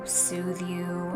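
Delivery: ordinary talk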